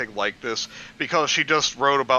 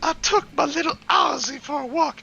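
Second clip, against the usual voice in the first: character voice; 14 semitones higher than usual. Pretend. Playing a character answering.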